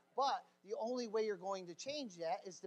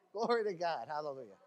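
ordinary talk

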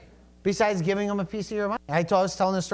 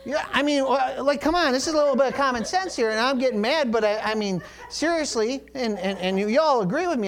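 worked up; 7 semitones higher than usual. Real, not acted. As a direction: rev up the congregation, half laughing. Preacher worked up, laughing.

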